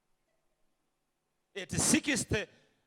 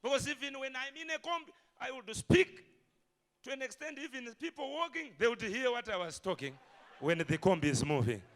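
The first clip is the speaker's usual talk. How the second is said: ordinary talk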